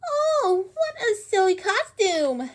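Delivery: mock Dragon in a squeaky voice; high jeering animal voices; mocking animal crowd. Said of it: "they cried".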